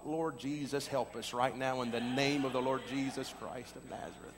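soft, low hushed plea under the breath; quiet prayer. Retold or imitated. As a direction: pray it to yourself, embarrassed and low. Silent prayer in embarrassment.

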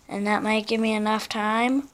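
ordinary talk

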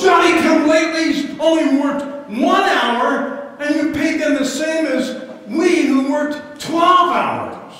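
high, loud, slow indignant protest; angry grumbling workers. Acted. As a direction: rant like an outraged cheated worker. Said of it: "They're saying".

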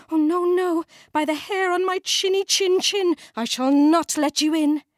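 high squeaky little pig voice; a small pig's high, defiant refusal. A narrator's reading.

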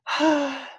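high falsetto lovesick sigh; Juliet's sigh. Acted. Teacher's Juliet sighing, high falsetto. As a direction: breathe out like a dreamy girl. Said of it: "Juliet voice".

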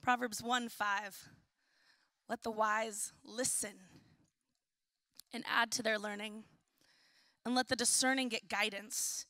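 hushed, deliberate Bible reading; scripture reading. Real, not acted. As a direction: read the verse measured, with pauses. Reads scripture aloud.

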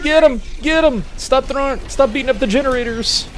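high frantic shouts; frantic yelling. Real, not acted. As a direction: yell urgently at enemies. Urging sentries, panicking.

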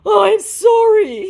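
high, loud mock begging; drawn-out hammed-up apology. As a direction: plead slowly in a high put-on voice. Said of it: "he begs".

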